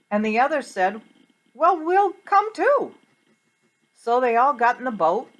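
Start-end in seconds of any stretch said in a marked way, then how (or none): 1.5-3.0 eager reply. Acted. Disciples answering Peter.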